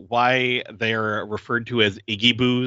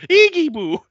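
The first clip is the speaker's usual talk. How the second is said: high squeaky Iggy Boo, louder; mock happy squeal; 8 dB louder than usual. Guard Larry blurting the catchphrase, high and loud. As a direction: squeal it out of nowhere. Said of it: "he just went".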